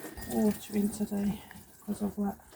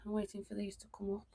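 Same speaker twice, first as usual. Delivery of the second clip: whispering; 9 dB quieter, faster than usual. Real, not acted. Whispered garden tour aside.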